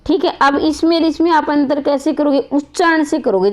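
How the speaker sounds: ordinary talk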